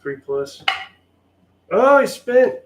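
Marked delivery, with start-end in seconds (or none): 1.7-2.6 loud, high-pitched surprise; surprised outburst. Real, not acted. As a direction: burst out at an unexpected roll. Reacts to unexpected spent roll.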